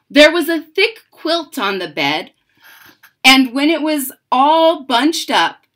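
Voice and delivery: put-on lively read-aloud voice for children; story narration. A narrator's reading. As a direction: read it like a bedtime picture book. Reads the picture book aloud.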